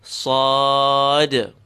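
sad with a long, level back ah; full-letter sound. Shown as a demonstration. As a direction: draw out the ah in sad, as in car. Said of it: "names of the full letters".

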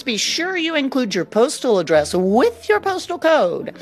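ordinary talk, nothing marked

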